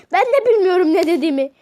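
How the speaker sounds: loud fast words through laughter; laughing. Real, not acted. Laughing at his own slip of Pepsi. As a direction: laugh the line out.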